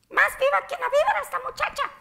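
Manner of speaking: high, loud, fast Spanish saying in a grandma's voice. Retold or imitated. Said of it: "my grandma, she told me".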